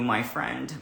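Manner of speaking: ordinary delivery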